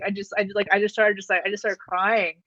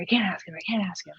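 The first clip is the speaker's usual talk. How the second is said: nervous whisper; 5 dB quieter, faster than usual. Retold or imitated.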